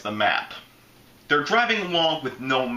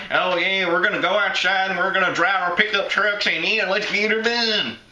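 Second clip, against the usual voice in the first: Southern caricature, higher and hollering a little; exaggerated Southern accent; 6 semitones higher than usual. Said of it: "not every Southern is like".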